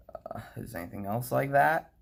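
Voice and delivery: ordinary talk, nothing marked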